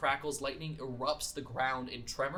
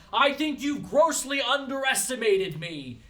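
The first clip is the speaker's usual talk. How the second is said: higher, louder defiant shout; a proud defiant yell; 8 semitones higher, 6 dB louder than usual. Acted. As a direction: shout it back at the enemy. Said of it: "and he shouts".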